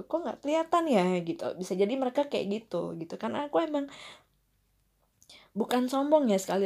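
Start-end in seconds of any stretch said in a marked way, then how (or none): 0.0-1.1 higher, louder mock astonishment; a schoolmate's puzzled wonder, a little louder; imitating friends' surprise. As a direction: play the friends, lightly amazed. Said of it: "maksudnya kayak mereka".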